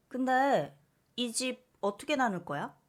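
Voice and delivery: mother fishing for her share; a hint of a scheming mother; greedy mother. Mother reveals she wants house.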